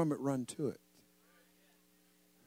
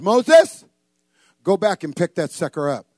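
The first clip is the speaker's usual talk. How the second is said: loud shouted name, then firm order; acted divine command, loud; commanding call; 11 dB louder than usual. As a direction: boom it out as God's order. God orders Moses back.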